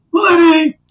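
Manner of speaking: put-on loud, very high old-man holler; hammed-up high-pitched shout; mentor impression. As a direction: call out like the older mentor. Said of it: "he talks like this".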